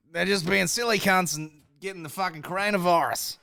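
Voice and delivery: high, hammed-up Australian accent; loud parody of an Australian narrator; mock Australian. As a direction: pitch it up in broad mock Australian.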